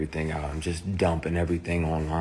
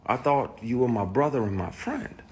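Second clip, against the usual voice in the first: ordinary talk, nothing marked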